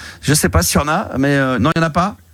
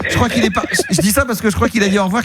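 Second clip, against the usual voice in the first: laughing; 6 semitones higher than usual. Real, not acted. Laughs about son on live.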